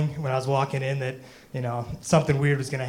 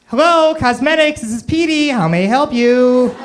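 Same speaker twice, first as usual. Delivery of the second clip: much higher, loud, slow falsetto clerk; fake phone voice; 9 semitones higher, 8 dB louder, slower than usual. Clerk pranking the caller as cosmetics Petey, squeaky falsetto. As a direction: play a prissy cosmetics clerk named Petey.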